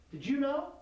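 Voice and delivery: loud, high accusing question; accusing demo. Shown as a demonstration. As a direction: hurl it at him, high and loud. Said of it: "wait for a response from Trevette".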